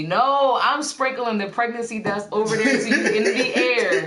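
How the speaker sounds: loud, high, hammy blessing; mock preacher blessing. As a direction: bestow it like a preacher. Mock blessing of pregnancy dust.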